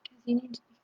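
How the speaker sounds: library whisper. Shown as a demonstration. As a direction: drop to a hush like in a library. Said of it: "I've had to whisper".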